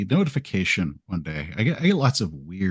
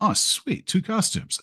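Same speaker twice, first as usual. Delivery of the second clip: high, delighted "oh sweet"; excited; 12 semitones higher, slower than usual. Retold or imitated. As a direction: squeal it with glee. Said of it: "And I'm like".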